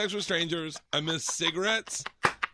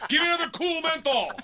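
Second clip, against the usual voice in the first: high-pitched comic holler; exaggerated high call for service; mock demanding call; 12 semitones higher than usual. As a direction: order it across the room, hammed up. Imitates Obama demanding a cigarette.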